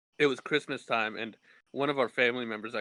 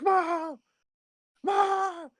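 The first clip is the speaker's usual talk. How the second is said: very high, loud mock shout; retold yell for mom; screaming for mom; 5 dB louder than usual. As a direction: call out for mom in panic. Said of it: "screaming for my mom".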